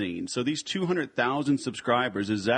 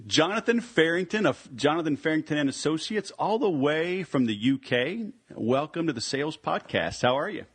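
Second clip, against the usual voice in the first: raised, bright introduction of the guest; announcer welcome; 5 semitones higher than usual. Acted. Host welcoming his UK guest, announcer style. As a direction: bring him on like a showman.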